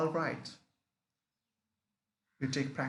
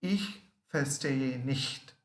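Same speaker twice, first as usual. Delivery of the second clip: Ich verstehe nicht said slowly, word by word; slow teaching demo. Shown as a demonstration. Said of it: "Next one we learn".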